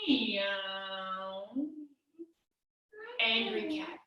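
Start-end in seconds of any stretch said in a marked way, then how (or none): 0.1-1.6 soft, low, drawn-out meow; happy cat meow. Pretend. Teacher playing happy cat for kids, soft contented meow. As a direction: stretch out a gentle meow. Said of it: "be a happy cat".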